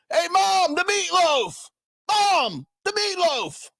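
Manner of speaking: high-pitched whiny yell at mom; Will Ferrell yell. Retold or imitated. Will Ferrell's character calling to his mother about meatloaf, high whiny yell. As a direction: bellow for mom like an overgrown kid. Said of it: "like Will Ferrell in Wedding Crashers".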